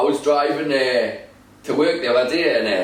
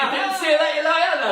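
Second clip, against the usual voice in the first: laughing taunt; 11 semitones higher than usual. Retold or imitated. Teasing driver at traffic light.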